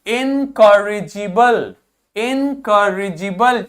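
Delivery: इनकॉरिजिबल spoken slowly, syllable by syllable; slow model pronunciation. Shown as a demonstration. Teacher drills इनकॉरिजिबल slowly with students. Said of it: "क्या शब्द हो गया".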